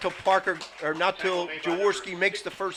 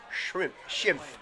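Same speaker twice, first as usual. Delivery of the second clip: ordinary talk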